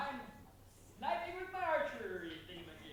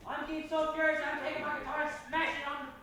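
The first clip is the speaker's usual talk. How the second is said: mock fury; 3 semitones higher, 10 dB louder, faster than usual. Player acts out rage.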